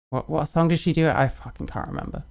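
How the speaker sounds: normal talking voice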